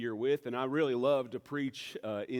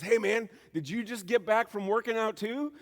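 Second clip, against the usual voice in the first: high, loud, cheery greeting; pitched-up mock-chummy question, laugh in the voice; smiling neighbor; 10 semitones higher, 5 dB louder than usual. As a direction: imitate a grinning, friendly neighbor. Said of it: "he had this big smile on his face".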